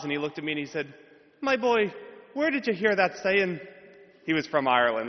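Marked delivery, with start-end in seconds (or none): 1.4-3.6 high, lilting Irish professor voice; hammed-up Irish accent, pitched up. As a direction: put on a stage Irish brogue for the old professor. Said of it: "Lucky Charms accent".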